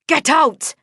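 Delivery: high, loud yell; yelling at the lingering man. A narrator's reading. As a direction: bark it at the man by the door. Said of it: "I yelled".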